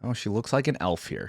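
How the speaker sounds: usual speaking voice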